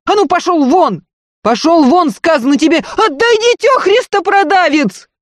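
high, loud peasant tirade; powerful wail. A narrator's reading. Maid defending her lady and the baby, wailing loudly. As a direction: yell in furious protest, pitch climbing at the end. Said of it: "Дунькин вопль оказался такой силой".

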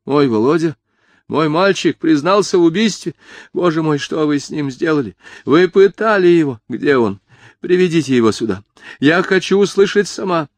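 high, agitated demands; frantic disbelief. A narrator's reading. Mother demanding to see her confessed son, frantic.

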